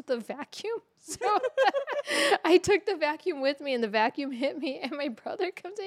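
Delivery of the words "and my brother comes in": ordinary talk, nothing marked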